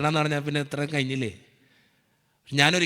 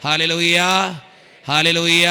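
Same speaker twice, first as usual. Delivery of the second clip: building hallelujah; 8 dB louder, slower than usual. Shown as a demonstration. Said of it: "വലതുകരം ഉയർത്തി പറ".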